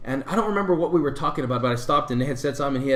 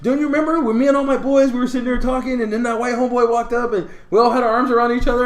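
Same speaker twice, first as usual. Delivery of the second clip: excited reminiscing; 9 semitones higher, 6 dB louder than usual. Retold or imitated. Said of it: "And he was like".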